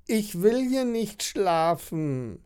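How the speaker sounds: high, slow, drawn-out whine; whining child. A narrator's reading. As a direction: whine like a child refusing to stay. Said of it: "jammert Mona". (hohes, gedehntes Kinderjammern)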